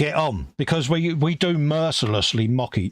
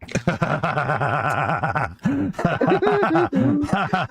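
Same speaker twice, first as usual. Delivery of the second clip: long rattling laugh rising high; 5 semitones higher than usual. Real, not acted. Mate teased about his secret accent, laughing hard. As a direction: burst into a drawn-out cackle.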